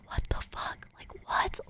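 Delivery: hushed, whispered disbelief; barely voiced bewildered reaction; baffled whisper. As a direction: whisper it, stunned and confused. Baffled friends staring at phone.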